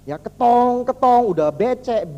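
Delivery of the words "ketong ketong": drawn-out, evenly repeated metallic pounding; imitated pile-driver clang. Speaker showing how foundations are built, imitated pounding. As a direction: sound out each heavy blow on one note.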